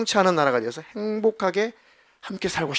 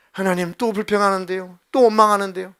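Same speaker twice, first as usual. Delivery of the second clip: exasperated complaint; 5 semitones higher, 5 dB louder than usual. Acted. Moses complains to God.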